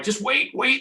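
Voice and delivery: high, loud urgent call; the crew shouting at him to hold; yelling. Retold or imitated. Crew yelling at him on the boat to wait, loud. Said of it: "everybody's yelling on the boat".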